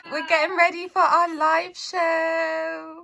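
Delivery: high, loud laughing words; laughing excitedly. Real, not acted. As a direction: laugh through it, slowly and loud. Laughing excitedly before live show.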